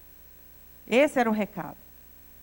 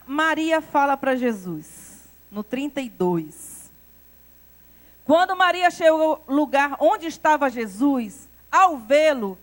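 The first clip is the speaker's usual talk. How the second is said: ordinary delivery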